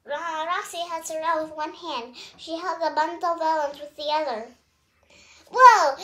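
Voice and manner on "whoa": very loud high whoa; thrilled whoa. A narrator's reading. Terrell soaring over Metro City, thrilled whoop. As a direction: whoop with delight in flight.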